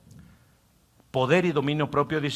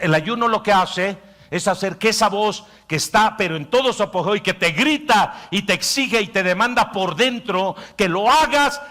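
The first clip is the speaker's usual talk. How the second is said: higher, louder preaching climb; full-force preaching; 8 semitones higher, 8 dB louder than usual. Acted. Pastor preaching about fasting, full force. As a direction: drive it home to the congregation.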